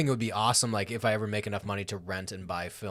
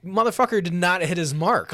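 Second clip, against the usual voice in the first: mock outrage; 7 semitones higher, 9 dB louder than usual. Imitating a viewer's outrage.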